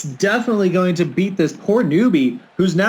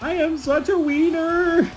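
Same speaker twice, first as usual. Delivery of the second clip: silly clown; 10 semitones higher than usual. Pretend. Silly self-mocking line.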